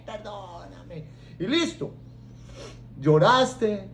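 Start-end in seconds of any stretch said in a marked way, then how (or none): 0.0-1.1 hammed-up sobbing. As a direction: wail like a repentant sinner. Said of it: "A humillarse". (llanto exagerado de arrepentido)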